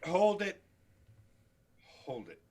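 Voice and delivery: usual speaking voice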